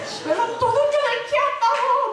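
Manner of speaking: high-pitched, hammed-up crying of a mother; loudly lamenting in a high, put-on sob; mock wailing. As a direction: imitate mom wailing for everyone to hear. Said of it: "막 들으라고 막 울어요".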